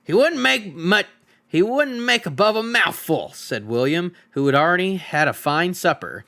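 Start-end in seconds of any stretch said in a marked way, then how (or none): 0.0-3.3 scoffing troll. Acted. Full troll William scoffs at the tiny hobbit. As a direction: dismiss the hobbit as a mere mouthful.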